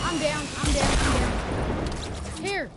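urgent shout to teammates; loud repeated call for help. Real, not acted. Calls teammates after being knocked.

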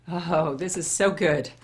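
ordinary talk

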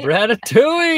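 "Bratatouille" called out high and loud; high, loud, gleeful echo of a name; excited call-out. Real, not acted. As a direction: belt it out like a hype man. Excitedly echoing a viewer's name.

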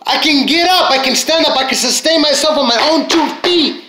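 gasp, then high-pitched rejoicing; amazed elation. Acted. Speaker acting out getting up in the morning, elated. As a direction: marvel at each thing you can do.

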